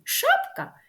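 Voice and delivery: high teasing rise on "Шапка"; high playful question. Acted. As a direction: ask it like a cheeky guess. Playful question in kids' poem.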